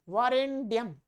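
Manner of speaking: वारेण्यम quickly as vaa-ren-dyam; mispronounced D sound. Shown as a demonstration. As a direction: break वारेण्यम with a hard 'd'. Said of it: "ड बोल देते हैं". (वारेण्यम में ण की जगह ड)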